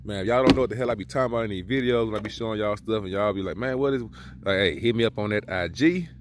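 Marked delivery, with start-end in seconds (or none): none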